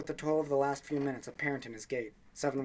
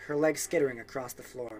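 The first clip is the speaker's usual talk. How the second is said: plain reading voice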